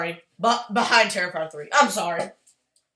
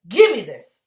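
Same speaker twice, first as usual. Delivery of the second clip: loud; 13 dB louder than usual. Real, not acted.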